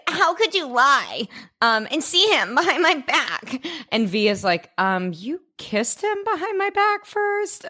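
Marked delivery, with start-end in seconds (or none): 0.1-3.7 high-pitched imitation of outraged accusation; hammed-up hurt fury, pitched up; mock furious. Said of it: "little J is fucking furious". 4.8-7.7 very high, slow incredulous comeback; mock indignant retort. Retold retort in a quarrel.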